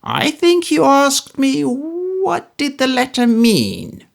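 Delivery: put-on high, slow, needling old miser's voice; Smallweed building to his threat; sly menacing old man. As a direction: draw it out before the strike.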